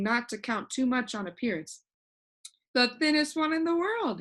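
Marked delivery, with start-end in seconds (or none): 2.7-4.2 brightly. A narrator's reading. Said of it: "he replied brightly".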